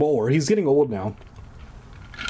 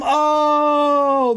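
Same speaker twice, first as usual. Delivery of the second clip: surprised; 13 semitones higher, 5 dB louder than usual. Real, not acted. Noticing the listener.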